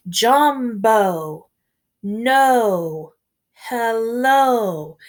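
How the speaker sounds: Jambo, no, hello each held on the O; stressing the O ending. Shown as a demonstration. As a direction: lean on the final O of each word. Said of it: "all end with an O sound".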